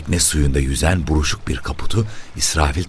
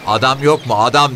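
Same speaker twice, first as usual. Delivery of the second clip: loud, higher-pitched call; 10 semitones higher, 5 dB louder than usual. A narrator's reading. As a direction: call out impatiently for someone. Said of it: "Kadın tekrar bağırdı". (yüksek sesle seslenerek)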